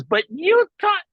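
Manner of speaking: high, loud, drawn-out squawk of complaint; mock wife's protest. Man mimicking his wife arguing back, high mock protest. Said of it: "She goes".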